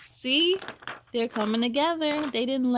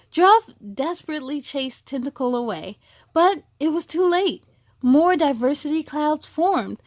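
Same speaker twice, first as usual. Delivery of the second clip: gentle storybook reading; storyteller voice for children; story narration; 3 semitones higher, 6 dB louder than usual. A narrator's reading. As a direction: read it like a bedtime story.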